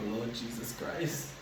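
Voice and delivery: very soft, slow, dreading mutter; hushed prayer of dismay. Retold or imitated. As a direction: breathe it out slowly in dread. Acted dread before police.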